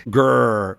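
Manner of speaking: drawn-out spoken "grr"; playful mock dog growl; imitated watchdog growl.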